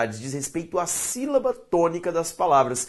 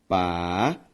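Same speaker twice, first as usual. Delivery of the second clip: "parede" split pa... re... de, long pauses; syllable by syllable; 7 semitones lower than usual. Shown as a demonstration. Teacher dividing a word for students, syllable by syllable. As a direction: stretch each syllable of "parede" on its own. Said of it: "ao separar a palavra".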